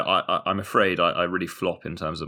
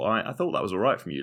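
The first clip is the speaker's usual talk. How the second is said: normal talking voice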